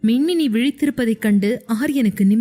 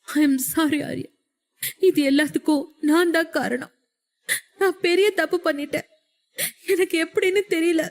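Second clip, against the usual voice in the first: sobbing apology; 6 semitones higher than usual. A narrator's reading. Wife sobs her apology to her husband for losing the baby. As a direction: plead for forgiveness through tears.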